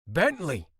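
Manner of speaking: loud angry roar of a name. Acted. Ford storms into the house roaring his brother's name. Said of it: "I roared".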